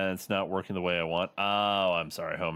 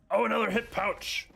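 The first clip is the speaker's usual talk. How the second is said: excited; 14 semitones higher, 3 dB louder than usual. Real, not acted. Finds loot in game.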